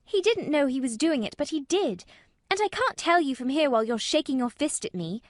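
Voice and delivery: obstinate protest. A narrator's reading. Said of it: "She protested obstinately".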